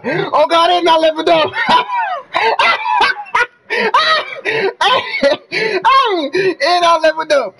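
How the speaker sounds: high yelling between shrieks of laughter; screaming disbelief. Real, not acted. Streamer shrieking in disbelief that he leveled up.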